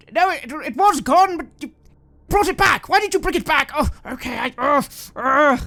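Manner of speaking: distressed man. Acted. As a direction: accuse them as the torment comes back. Man distressed as singing returns.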